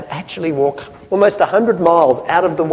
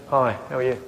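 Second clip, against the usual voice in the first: low, quiet, flat hello; disengaged greeting; 5 semitones lower, 8 dB quieter, faster than usual. Shown as a demonstration. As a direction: greet them softly with no interest. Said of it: "I'm gonna say the same words".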